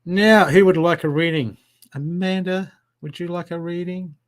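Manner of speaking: stretched-out host call for the next reading; calling out to viewers. Real, not acted. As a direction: invite the chat to come forward.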